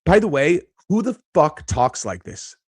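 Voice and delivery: ordinary talk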